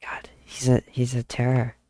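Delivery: ordinary delivery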